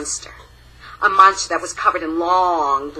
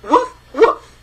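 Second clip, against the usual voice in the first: higher, louder woofs; mother mouse barking; 7 semitones higher, 5 dB louder than usual. Acted. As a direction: bark proudly like a dog. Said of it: "speak another language".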